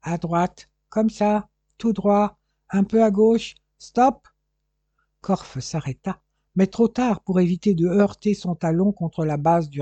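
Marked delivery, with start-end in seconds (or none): 0.0-4.3 short guiding orders, climbing in pitch; lightly voiced Englishman, higher and more urgent; Englishman giving directions. A narrator's reading. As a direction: steer him step by step, building to a louder halt.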